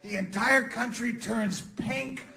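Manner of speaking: usual speaking voice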